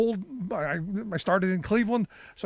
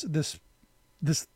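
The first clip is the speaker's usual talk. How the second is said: ordinary delivery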